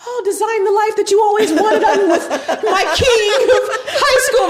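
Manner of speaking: high, loud, laughing mock speaker voice; mocking herself. Mother mocking her own motivational talks, laughing high. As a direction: lampoon your own keynote through laughter. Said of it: "I'm speaking all over the country like".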